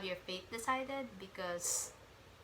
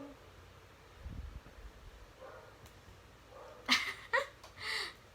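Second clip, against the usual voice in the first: high breathy laughing fit; 14 semitones higher than usual. Real, not acted. Long laugh at annoying hero.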